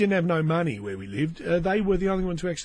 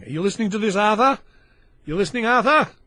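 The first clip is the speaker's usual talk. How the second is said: raised, high nagging call; calling out to Arthur; 5 semitones higher, 6 dB louder, faster than usual. Pretend. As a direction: check loudly that Arthur is paying attention.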